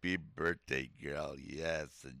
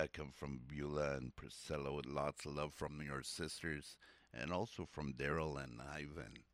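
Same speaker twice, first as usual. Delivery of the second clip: ordinary talk, nothing marked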